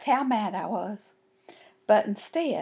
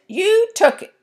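high, loud accusing cry; shrieked; 11 semitones higher, 6 dB louder than usual. A narrator's reading. Girl accusing her sister, shrieking. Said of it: "I shrieked".

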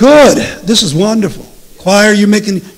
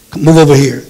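normal talking voice

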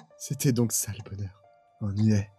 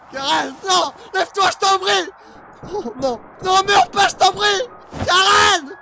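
desperate shouting; 6 dB louder than usual. Acted. Lover shot, begging her to live.

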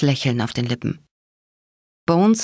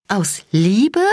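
stunned disbelief; 5 semitones higher than usual. A narrator's reading. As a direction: throw his word back, incredulous. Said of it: "fragte ich fassungslos".

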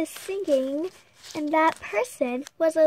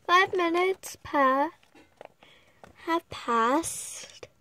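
ordinary delivery, nothing marked

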